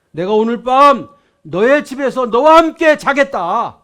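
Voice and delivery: tender call that swells into a loud promise; Jesus calling. Acted. Jesus calls to Zacchaeus in the tree, tender then booming. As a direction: call him down gently, then proclaim.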